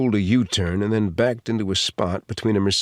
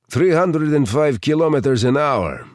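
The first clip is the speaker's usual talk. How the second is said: put-on higher, louder Russian-accented answer; Russian accent, pitched up and projected; Saint Petersburg Russian accent; 6 semitones higher, 5 dB louder than usual. A narrator's reading. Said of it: "with a perfect Saint Petersburg accent".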